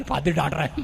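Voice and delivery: very low, soft aside; hushed complaint. Retold or imitated. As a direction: voice a woman's small, subdued grumble. Woman's hushed complaint, retold. (बहुत धीमी, दबी आवाज़ में शिकायत)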